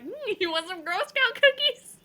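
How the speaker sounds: put-on high, sing-song creepy kid; sweet creepy child voice. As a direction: ask like a sweetly sinister child. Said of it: "knock at my door and be like".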